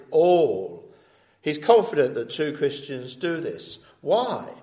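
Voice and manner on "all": "all" louder and drawn out; emphatic stress. Shown as a demonstration. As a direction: land heavily on "all". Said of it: "an emphatic".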